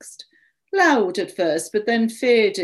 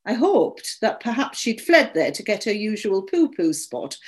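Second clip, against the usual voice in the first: usual speaking voice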